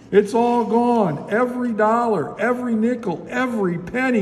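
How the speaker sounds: high, wailing storybook lament; moaning. A narrator's reading. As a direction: wail it out like a distraught bank manager. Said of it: "he moaned".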